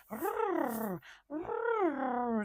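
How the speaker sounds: mock hackles-up little growl; kitten growl. Tiny kitten growling at the house cat, imitated as a small mock growl. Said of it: "she would growl as a little tiny kitten".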